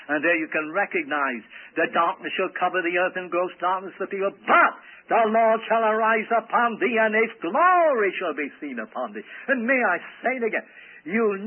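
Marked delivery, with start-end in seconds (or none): 1.8-9.2 steady reading that builds to a loud, raised promise; scripture declamation. Real, not acted. Preacher reading Isaiah to the congregation, building scripture declamation. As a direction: swell strongly from the word "but".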